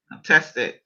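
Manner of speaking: ordinary delivery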